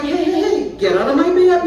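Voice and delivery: high-pitched startled protest; alarmed shooing. Acted. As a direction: shoo the dog off in mock alarm. Acted shooing of dog from bed.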